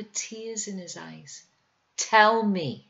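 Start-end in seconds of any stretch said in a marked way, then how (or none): 2.0-2.9 loud, insistent demand; insisting. Acted. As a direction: push hard, she won't take no. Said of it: "she insisted".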